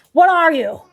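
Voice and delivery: shouting at a cook on a cooking show; mock Gordon Ramsay yell. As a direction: bellow it like an angry TV chef.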